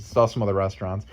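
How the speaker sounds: usual speaking voice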